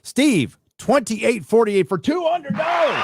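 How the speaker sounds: calls out the donor's name and amount, shouted high and loud; big excited announcer yell. Real, not acted. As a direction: belt it out like a game-show host. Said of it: "I can yell".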